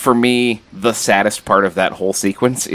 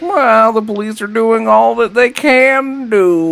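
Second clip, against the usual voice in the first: very high, slow, drawn-out put-on voice; exaggerated casual parent, pitched way up; mock laid-back dad; 4 dB louder, slower than usual. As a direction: imitate an unbothered dad, stretching the words high. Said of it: "laid back in that when his children disappear".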